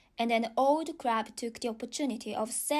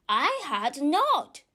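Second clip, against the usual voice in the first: a slow, louder, sharply angry denial; sharp angry retort; 4 semitones higher, 6 dB louder, slower than usual. A narrator's reading. Mouse snapping angrily at Alice's mistake, slow and louder. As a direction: snap back at her, slow and loud. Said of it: "sharply and very angrily".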